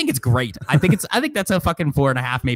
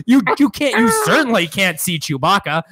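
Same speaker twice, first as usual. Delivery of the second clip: ordinary delivery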